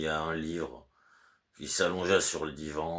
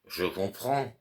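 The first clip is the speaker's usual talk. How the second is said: higher, scornful echo of the servant's words; mocking repeat; 7 semitones higher than usual. Master mocking the servant's curt reply, higher and scornful. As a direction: throw his own words back at him. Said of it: "Tu ne peux pas dire, oui, monsieur".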